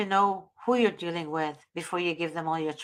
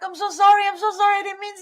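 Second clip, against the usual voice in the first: apologizing; 12 semitones higher, 4 dB louder, faster than usual. Retold or imitated. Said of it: "And I'm like".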